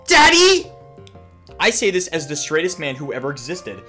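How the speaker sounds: ordinary delivery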